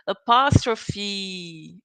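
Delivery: "Apostrophe" very slow, sounding the final E clearly, a-POS-tro-phee; final E pronounced. Shown as a demonstration. Teacher shows students to say the final E, very slow. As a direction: stretch "Apostrophe" and land on the final E. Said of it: "don't forget to pronounce the E at the end".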